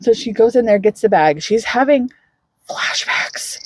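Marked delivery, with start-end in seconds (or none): 2.7-3.6 breathy hushed whisper; whispered for effect. Acted. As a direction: drop to a whisper on the word. Whispered word in retelling.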